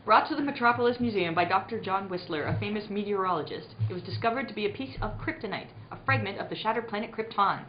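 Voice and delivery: ordinary delivery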